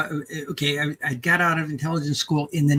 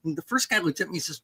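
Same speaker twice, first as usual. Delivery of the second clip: ordinary talk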